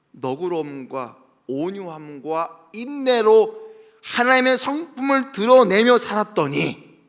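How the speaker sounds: ordinary talk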